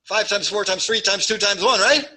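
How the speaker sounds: quick, eager high recital; rushed wrong answer. Shown as a demonstration. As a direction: rattle it off too fast. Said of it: "No, slow down".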